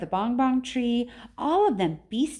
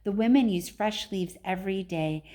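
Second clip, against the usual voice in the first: ordinary delivery, nothing marked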